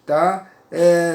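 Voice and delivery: normal talking voice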